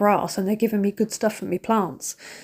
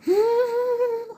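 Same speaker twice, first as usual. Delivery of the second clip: long high gasp, mimicking welling up; imitated held-back tears. Showing how she hides tears at the show, high mock gasp. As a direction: draw out a high, stifled almost-crying noise. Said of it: "I'm trying to hide it".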